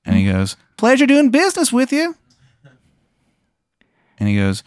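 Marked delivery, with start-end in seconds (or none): 0.8-2.2 very high-pitched cartoon character voice; high put-on mascot voice; Tim the Tooth. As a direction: squeak out a sign-off in falsetto. Tim the Tooth says goodbye.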